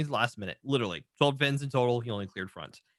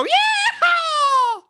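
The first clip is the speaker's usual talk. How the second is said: very high shouted cowboy whoop; full-out yeehaw; 8 dB louder than usual. Shown as a demonstration. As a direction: demonstrate a huge rodeo holler. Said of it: "You gotta go".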